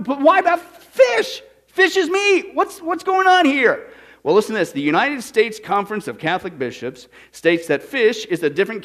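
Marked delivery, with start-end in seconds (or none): 0.0-3.9 high, slow incredulous cry; hammed-up disbelief at the rule; rising, drawn-out protest; mock outrage. Mock outrage, much higher.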